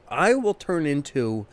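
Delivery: ordinary talk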